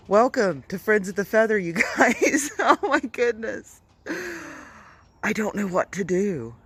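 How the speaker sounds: high, loud panicked laughing; hysterical laughter. Real, not acted. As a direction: laugh helplessly in shock. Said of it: "my panic attack at the end is pretty funny".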